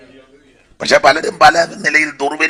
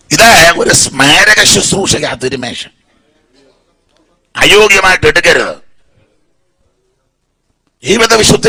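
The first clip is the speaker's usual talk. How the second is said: raised, forceful pulpit list; fiery preaching; 3 semitones higher, 3 dB louder than usual. Acted. Preacher warning the congregation who must not take communion, fiery. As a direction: hammer each group home like a warning.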